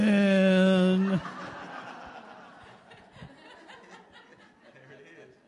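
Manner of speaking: big warm laugh; loud laughter. Real, not acted. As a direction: burst out laughing. Laughter after the story.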